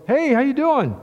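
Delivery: put-on bright, high friendly hello from the old boss; cheery greeting. Boss greets him on phone.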